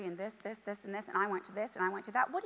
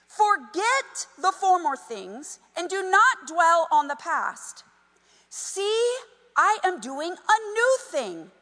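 scripture reading; 5 semitones higher, 4 dB louder, slower than usual. Acted. Reads scripture to congregation.

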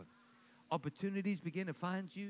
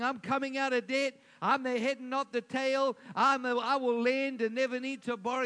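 louder, confident proclaiming from the pulpit; bold declaration; 4 semitones higher, 6 dB louder than usual. Shown as a demonstration. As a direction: declare it like it's already done. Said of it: "So you gotta be saying".

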